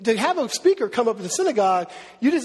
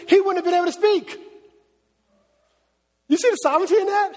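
building excitement; 5 semitones higher than usual. Real, not acted. Pastor's rising excitement about sovereignty.

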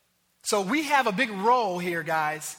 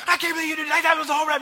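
mock yelling manager; 6 semitones higher, 4 dB louder, much faster than usual. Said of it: "screaming at him".

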